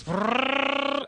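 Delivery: high grinding rattle; engine grinding noise. Retold or imitated. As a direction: imitate a wood chipper engine. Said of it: "and it goes".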